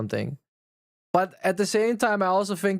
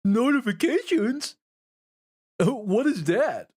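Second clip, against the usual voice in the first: clueless chatter; 7 semitones higher, slower than usual. Retold or imitated. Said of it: "they hit me with the".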